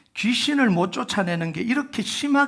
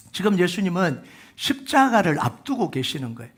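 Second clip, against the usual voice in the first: ordinary delivery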